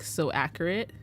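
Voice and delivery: usual speaking voice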